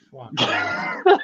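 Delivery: a sudden very high yelp; mock shocked squeak. Reacting to his sister's claim with a high mock yelp. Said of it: "I was like".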